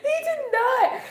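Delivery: high, drawn-out squeal through laughter; laughing disbelief. Real, not acted. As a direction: still cracking up, slow and squeaky. Laughing disbelief after the story.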